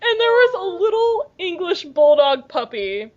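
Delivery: high, slowed coo over a puppy; gushing. Real, not acted. Describing the bulldog puppy to co-hosts, gushing. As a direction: draw it out, high and adoring.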